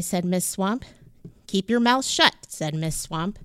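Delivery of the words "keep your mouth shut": loud, higher strict command; a loud, high witch-teacher order; stern order. A narrator's reading. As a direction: boss the class into silence.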